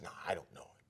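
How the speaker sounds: low, whispered denial; very soft, furtive disowning; hushed denial. Acted. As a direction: whisper it as if afraid to be overheard. Peter's first hushed denial, acted.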